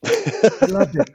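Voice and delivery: slow, high hammed-up Canadian accent. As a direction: Terrance and Phillip Canadian accent. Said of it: "Is that your Canadian accent, [name]?".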